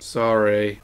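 low, soft sorry; small, subdued apology; sheepish apology. Real, not acted. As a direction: drop right down after an outburst. Sheepish apology after shouting.

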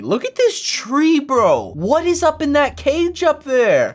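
high-pitched, swooping wonder; amazed. Real, not acted. Looking at the cage up there, amazed. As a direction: exclaim it high, sliding up and down.

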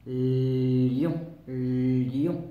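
lion with a long, loud, lingering L; stressing the L. Shown as a demonstration. Teacher to pupils, drawing out the L of lion. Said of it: "ça commence par".

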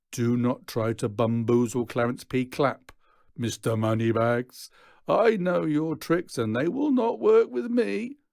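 high, loud, pompous villain voice; a strutting gang boss lecturing a rich man; pompous Clarence P. Acted. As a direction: puff up like a self-important leader. Clarence P voice in story.

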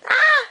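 short, high squeal; squeal, overwhelmed with delight; sudden high-pitched happy shriek. Real, not acted. Overwhelmed by the gift.

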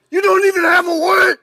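high, loud, breaking shout of disbelief; outraged shout. Real, not acted. Man shouting at officers who lack a warrant, outraged. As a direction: yell it at them like they've robbed you. Arrest standoff, no warrant shown.

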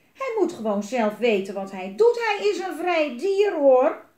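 put-on loud child's protest, climbing high; shouting protest. A narrator's reading. Twins defend the dragon's freedom, shouting in protest. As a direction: build up to a high indignant cry. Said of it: "gilden Jelmer en Elise".

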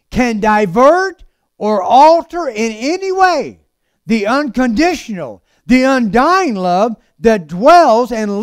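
drawn-out, swelling pulpit delivery; impassioned preaching. Acted. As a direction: stretch the big words, preach them out. Preaches at full force.

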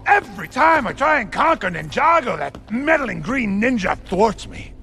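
high-pitched, worked-up tirade; exasperated rant. Acted. Warlord berates generals over losing to ninjas, exasperated rant. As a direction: rant at them, voice pitched right up.

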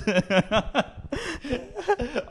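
high-pitched helpless laugh. Real, not acted. Teller cracking up at own toilet story, high laughter.

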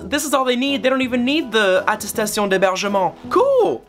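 high, gleeful rush of relief; excited. Retold or imitated. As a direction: celebrate like it's finally solved. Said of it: "and I'm like".

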